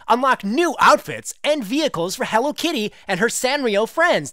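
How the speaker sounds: hammed-up commercial voiceover; crappy commercial read. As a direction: oversell it like a bad TV commercial. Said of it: "I'll read this as if it's, like, some crappy commercial".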